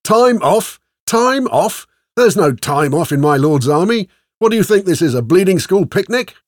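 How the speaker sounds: outraged high, loud roar; roaring sergeant. A narrator's reading. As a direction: roar in disbelief at your soldiers.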